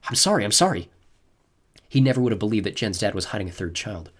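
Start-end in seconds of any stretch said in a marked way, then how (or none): none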